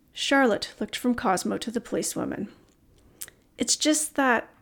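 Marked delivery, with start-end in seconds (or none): 3.6-4.5 trailing off, unsure where to go; hesitant. A narrator's reading. Charlotte to the policewoman, hesitant, trailing off. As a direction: hold back before the hard part. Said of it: "she hesitated".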